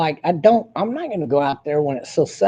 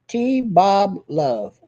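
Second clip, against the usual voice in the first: very slow, spelled out; 3 semitones higher, much slower than usual. Shown as a demonstration. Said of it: "repeats handle slowly".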